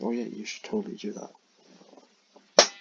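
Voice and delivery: loud, slow and eager, a different voice; eager friend's voice. Retold or imitated. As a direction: play the keen friend egging her on. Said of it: "And her friend's like".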